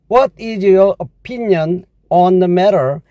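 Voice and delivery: very slow, careful English read; slow model sentence. Shown as a demonstration. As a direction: spell it out for the learner. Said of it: "주어진 영어 표현".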